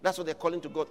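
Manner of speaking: ordinary talk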